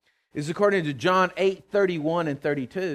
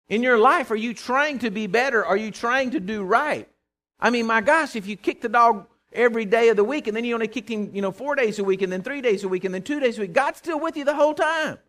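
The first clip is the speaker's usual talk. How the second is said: louder and higher, driving each question home; full-force preaching; 5 semitones higher, 5 dB louder than usual. Acted. Preacher rousing the congregation, full-force preaching. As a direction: preach it out, pressing the crowd.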